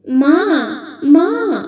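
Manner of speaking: put-on drawn-out child's cry to mother; calling for mother. A narrator's reading. Boy runs toward his mother's figure, crying out. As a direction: call out longingly, voice swooping up.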